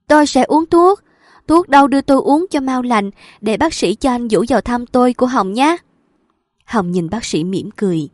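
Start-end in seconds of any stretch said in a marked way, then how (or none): 0.1-5.8 high, loud eager promise; eager pleading. A narrator's reading. Patient promising the doctor to take medicine, high eager plea. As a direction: clasp your hands and beg eagerly. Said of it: "chắp hai tay lại nói".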